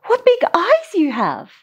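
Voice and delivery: high, gasping surprise; gasped. Acted. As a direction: gasp it out wide-eyed.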